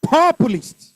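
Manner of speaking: Populist, loud and high on "Pop"; stress on first syllable. Shown as a demonstration. Teacher showing the class stress patterns, Populist stressed on "Pop". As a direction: punch the opening syllable of Populist. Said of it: "First syllable".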